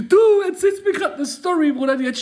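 laughing. Real, not acted. Amused at friend's story.

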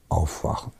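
Aufwachen whispered softly, rising at the end; whispered answer. Real, not acted. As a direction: breathe the one word like a secret. Ein-Wort-Antwort auf alle Fragen. („Aufwachen“ geflüstert, leise ansteigend)

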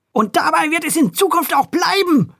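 high, sudden sharp snarl. A narrator's reading. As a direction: snap at him fiercely. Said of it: "fauchte die Kesse Kessi".

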